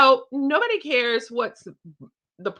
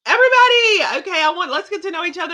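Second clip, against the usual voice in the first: louder and higher, pulling the room together; calling out to room; 7 semitones higher, 8 dB louder, faster than usual. Shown as a demonstration. Calling the party guests together, loud and high. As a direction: gather party guests for introductions.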